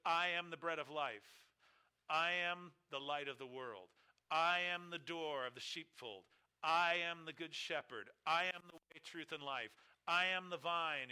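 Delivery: strong stress on I each time; emphatic I am. Shown as a demonstration. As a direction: hammer the word I in every I am. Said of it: "statements that have an emphatic self-revelation".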